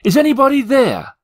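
Two Flower calling into empty corridors; shouting. Acted. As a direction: call out into the dark tunnels. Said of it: "he shouted".

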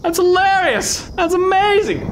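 excited squeal. Real, not acted. Delighted reaction to meme.

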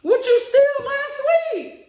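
high, slow, shocked question. Real, not acted. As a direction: stretch it out in disbelief. Shocked at the confession.

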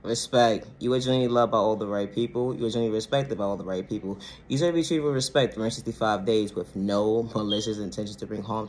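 low, fast, steady affirmation read; calm affirmations. Acted. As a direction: speak each blessing evenly, like a mantra. Closing affirmations, calm outro.

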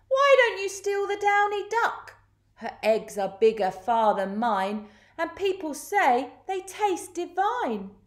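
high, loud hen voice; clucking hen. A narrator's reading. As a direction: talk like a persuasive clucking hen. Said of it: "the fat red hen began to cluck".

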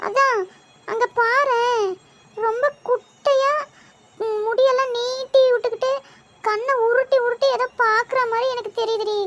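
a frightened, half-crying voice; scared whisper-like telling. Acted. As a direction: describe the ghost while near tears. Scared girl describing the ghost.

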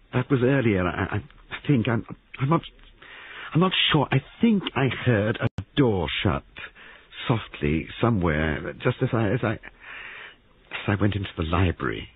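high, halting recollection; rattled witness groping for words; shaken, hesitant. A narrator's reading. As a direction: falter through it as if still in shock. Shaken witness struggles to recall.

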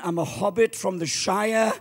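usual speaking voice

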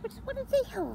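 voicing a little dog. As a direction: speak up high for the pet. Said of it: "she said".

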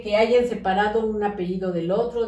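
usual speaking voice

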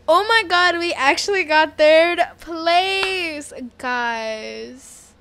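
loud, high, stretched-out excited cry; thrilled surprise. Real, not acted. Player telling viewers she actually got third place, thrilled. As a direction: burst out in disbelief at the win.

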